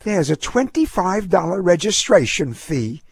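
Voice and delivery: lady clerk. A narrator's reading. Lady behind the counter tells the fee, official.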